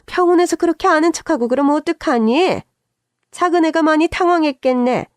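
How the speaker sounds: high, fussing mother-in-law; reproachful scolding. A narrator's reading. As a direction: chide a daughter-in-law, taking the other's side.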